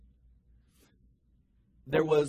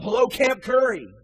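loud, higher call up from below; hollered reply; 9 semitones higher, 9 dB louder than usual. Retold or imitated. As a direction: call it up the cliff. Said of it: "the other one would holler up".

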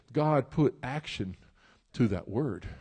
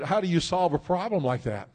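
usual speaking voice

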